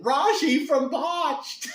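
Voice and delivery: high, loud, drawn-out mock Irish; excited attempt at an Irish accent; imitated Irish accent. Irish family recognizing a TV personality, badly faked Irish accent. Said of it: "with their Irish accent".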